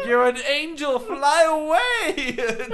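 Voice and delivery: very high, slow, laughing wail; drawn-out mournful farewell, cracking with laughter; mock-tearful falsetto send-off; high wailing Broken Matt Hardy. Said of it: "He's like".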